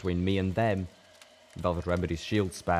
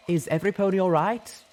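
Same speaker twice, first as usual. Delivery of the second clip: high, raised voice asking after everyone; concerned stallion; 12 semitones higher, 5 dB louder than usual. A narrator's reading. Life Bloom checks on his companions, high and concerned. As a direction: call out worried for the group.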